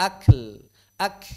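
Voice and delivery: "Akl" with an aspirated k; no added vowel after k in "Akl"; breath on kaf. Shown as a demonstration. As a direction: release the k of "ak" with air only. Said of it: "Ya begitu".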